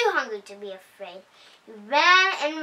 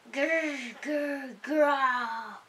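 soft growl; 6 dB quieter, much slower than usual. A narrator's reading. Scariest sound in the forest.

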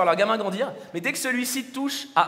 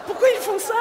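exasperated; 11 semitones higher than usual. Acted. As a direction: throw up your hands, pitch way up. Exasperated reaction to baby names.

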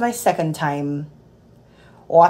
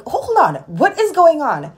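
startled patient; 10 semitones higher, 5 dB louder, slower than usual. Retold or imitated. Frightened man, tube without warning.

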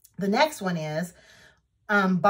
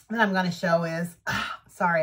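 normal talking voice

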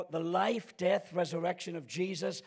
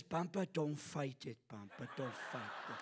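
hushed coaxing; 6 semitones lower, 9 dB quieter than usual. Retold or imitated. Granddaughter coaxing grandfather in story.

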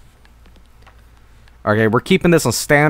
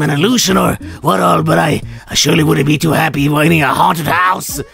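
put-on old man voice; 6 semitones higher than usual. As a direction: ham up a quirky old scientist. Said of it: "old man voice".

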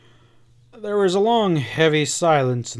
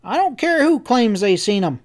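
high, louder, stubborn cowboy voice; resolute; 9 semitones higher, 5 dB louder than usual. A narrator's reading. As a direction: insist firmly, pitched up high. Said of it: "Sam went on resolutely".

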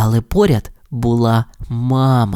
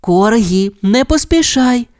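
higher, louder mother call; 8 semitones higher than usual. A narrator's reading. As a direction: call after the pup, more urgent. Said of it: "мама гукала ще дужче". (мама гукає цуценя ще дужче)

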